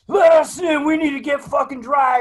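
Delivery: high-pitched mock yell through a door; acted pleading shout. Marines pounding on the porta shitters to get in, high mock shouting.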